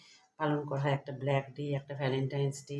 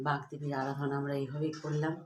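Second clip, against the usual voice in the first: ordinary talk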